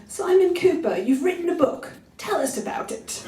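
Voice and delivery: a hint of a clueless radio host; mocking radio host. Retelling the radio host who hadn't read the book, lightly mocking. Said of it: "the person said to".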